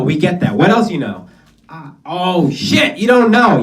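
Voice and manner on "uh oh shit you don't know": high, loud teasing gotcha; mock gotcha. Teasing a stumped audience member, loud and high.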